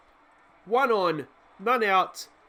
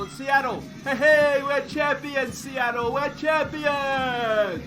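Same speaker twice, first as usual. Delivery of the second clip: higher, hollered and stretched out; triumphant shout; 5 semitones higher, much slower than usual. Real, not acted. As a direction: call out the title to the whole city. Celebrating to the city.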